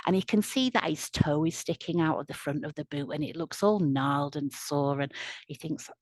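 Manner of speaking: usual speaking voice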